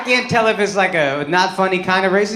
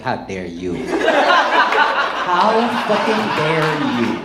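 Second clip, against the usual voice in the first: mock outrage; 7 semitones lower, much slower than usual. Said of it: "I'd be like".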